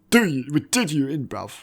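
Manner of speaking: brash London "bruv" swagger; London street accent. Retold or imitated. Janice brags to Regina about ruining her, brash London accent.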